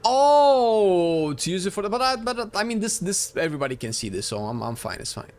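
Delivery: ordinary delivery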